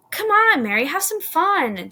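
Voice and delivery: high, loud playful call; playful urging. A narrator's reading. Jill urging Mary to join the snowball fun, playful. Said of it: "laughing, while scooping up more snow".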